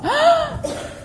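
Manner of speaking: high, loud gasp; surprised gasp. Acted. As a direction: gasp in delight. Sees coins.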